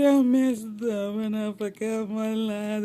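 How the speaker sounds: loud, drawn-out bawling; mock crying. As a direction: wail it slowly, hammed-up, like a child confessing. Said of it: "Girl, why you crying?".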